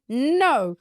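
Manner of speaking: acted-out cross answer; annoyed denial. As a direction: fire back in a mock huff. Annoyed answer to higher self.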